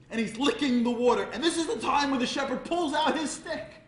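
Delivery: rising to a high, loud climax; building excitement. A narrator's reading. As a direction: drive the chase up to a shout. Breathless climax of the chase.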